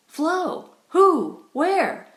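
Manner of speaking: high, loud, breathless questions; breathless outburst. A narrator's reading. Glenn striding in, calling breathlessly for Flo. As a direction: burst in gasping for answers. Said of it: "he began breathlessly".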